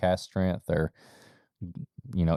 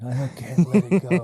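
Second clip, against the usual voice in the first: laughing; 6 semitones higher, 5 dB louder than usual. Retold or imitated. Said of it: "'cause I'm like".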